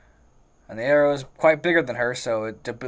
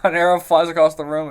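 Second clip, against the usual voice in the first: said louder over the noise; louder repeat; 3 semitones higher, 7 dB louder than usual. Real, not acted. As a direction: repeat it loud over the table. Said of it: "It's again".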